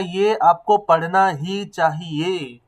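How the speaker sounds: slow, with stress on "आपको" and a long drawn-out "पढ़ना"; stressed words. Shown as a demonstration. Teacher to students, slow and stressing "आपको" and "पढ़ना". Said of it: "उन पे मैंने stress दिया".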